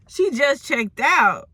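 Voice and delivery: high, slow, drawn-out disbelief; scandalized repeat. Acted. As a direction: stretch it out as a juicy revelation. Scandalized repeat of staff's words.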